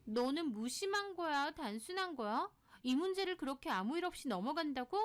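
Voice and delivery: high, agitated string of indignant questions; annoyed friend. Acted. Friend worked up that she let it go, indignant. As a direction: get worked up on her behalf, pitch up. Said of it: "소희가 좀 화를 내더라고요".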